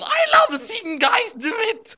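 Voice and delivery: very high, drawn-out gushing aside; gushing squeal. A narrator's reading.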